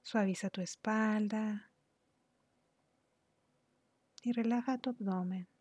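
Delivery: unhurried, soothing instructions that ease the listener into relaxation; guided meditation. Real, not acted. Guide leads listener through relaxing the body, calm guided meditation.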